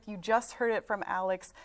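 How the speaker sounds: ordinary delivery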